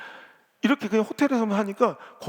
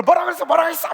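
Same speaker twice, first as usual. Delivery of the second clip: much higher, loud repeated what-did-you-say; furious demand; 9 semitones higher, 6 dB louder, faster than usual. Retold or imitated. Insulted deaconess demanding that her rival repeat it, high and loud. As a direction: flare up at the insult. Church women quarrel over cooking.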